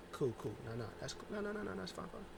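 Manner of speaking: Bayley masking irritation; forced calm. Retold or imitated. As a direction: swallow the annoyance quietly. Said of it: "Bayley's like".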